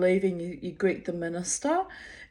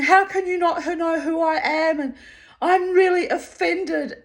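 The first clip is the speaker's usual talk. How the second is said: mock screaming; 8 semitones higher, 6 dB louder than usual. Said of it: "she's screaming at this minister".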